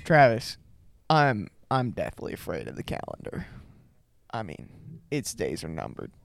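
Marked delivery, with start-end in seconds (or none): 1.1-6.1 low, hushed joke telling; deadpan joke delivery. Pretend. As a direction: drop it low and quiet, deadpan. Telling a joke to unlaughing friend.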